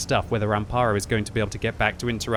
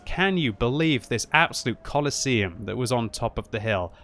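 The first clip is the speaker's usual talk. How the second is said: usual speaking voice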